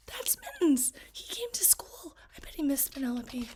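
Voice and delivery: excited classroom whisper. Acted. Classmates whispering about the cat at school. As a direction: whisper so the teacher won't hear.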